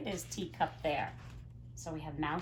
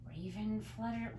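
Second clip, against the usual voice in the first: usual speaking voice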